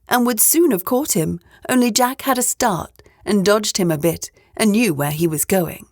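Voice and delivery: plain reading voice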